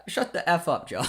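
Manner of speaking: amused, breaking into laughter; laughing. Real, not acted. Streamer mocking himself after the perfect cross, laughing. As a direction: laugh through the words. Laughing as player proves him wrong.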